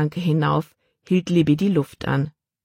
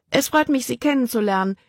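high-pitched, lightly rushed greeting; hurried; 8 semitones higher than usual. A narrator's reading. Libby greets the officers while hiccuping, hurried. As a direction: get the politeness out before a hiccup. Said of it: "sagte sie schnell".

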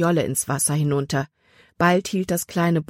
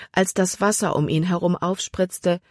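plain reading voice

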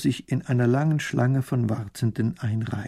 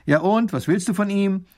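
higher, testy questioning; impatient; 8 semitones higher, 4 dB louder than usual. A narrator's reading. Minister quizzes the woman, impatiently. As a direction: press for an answer.

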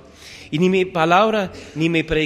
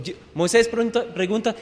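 ordinary talk, nothing marked

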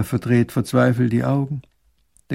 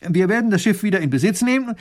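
higher, louder worked-up protest; indignant; 7 semitones higher, 3 dB louder than usual. Acted. Baron indignantly protesting to the captain, higher and louder. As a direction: bristle with outrage at the captain. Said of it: "Baron von Raffigny ereifert sich".

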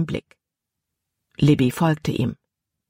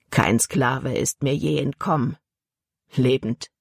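low-key growled warning, a little lowered; understated growl; 3 semitones lower than usual. A narrator's reading. Slave dealer warning his new slave, mildly growled. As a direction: warn him with a mild growl, dropping on the last word. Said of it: "dann knurrte er eine Warnung".

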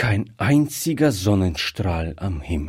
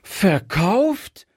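high, rising cry of disbelief; appalled little girl's question; horrified child; 10 semitones higher than usual. A narrator's reading. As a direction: exclaim it aghast, pitch shooting up. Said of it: "rief es ganz entsetzt".

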